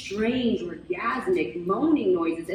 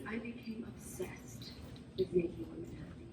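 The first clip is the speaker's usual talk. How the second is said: hushed confession; 15 dB quieter than usual. Acted. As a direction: drop to a hushed, much softer confession. Confiding an obsession to audience.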